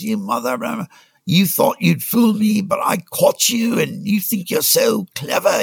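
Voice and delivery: high, slow mock screaming South African accent; imitating a screaming boss. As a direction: ham up the furious Dutch Afrikaner boss. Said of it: "starts screaming at me".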